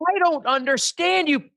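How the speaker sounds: very high, incredulous mock outburst; outraged customer. Customer hearing yet another offer from salesman, outraged. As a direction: act out indignant disbelief way up in pitch.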